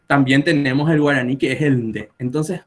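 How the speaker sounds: ordinary talk, nothing marked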